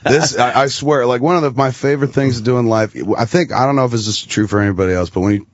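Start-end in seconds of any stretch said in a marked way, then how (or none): none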